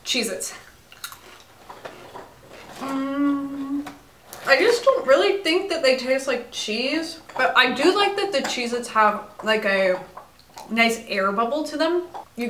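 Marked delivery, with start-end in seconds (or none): 2.8-12.5 biting crackers between words; chewing while talking. Real, not acted. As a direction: mouth partly full of cracker. Seen 0: Bites cracker at -4; nibbles crackers held at lips 3.0–7.9.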